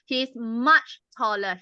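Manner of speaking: slow, clear He's much taller with much leaned on; teacher models the correct form, much stressed slowly. Shown as a demonstration. Teacher showing the club the right comparison, slow stress on much. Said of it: "We say much faster".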